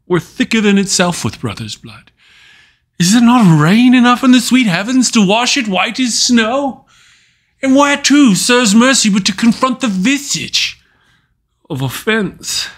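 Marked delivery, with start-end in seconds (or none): 3.0-12.7 raised, higher-pitched appeal; impassioned plea. Acted. The killer begs heaven's rain to cleanse his hand, impassioned plea. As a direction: plead upward in rising desperation.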